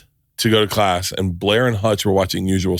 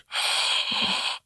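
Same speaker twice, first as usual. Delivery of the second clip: shocked gasp; 9 dB quieter than usual. Retold or imitated. Shocked at movie twist.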